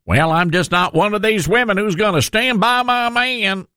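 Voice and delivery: mock Hillary's put-on Arkansas accent.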